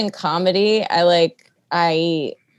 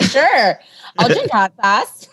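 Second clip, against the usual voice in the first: higher, louder game agreement; mock eagerness; 5 semitones higher, 4 dB louder, slower than usual. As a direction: play the over-willing performer saying yes. Mocking own eagerness for the industry.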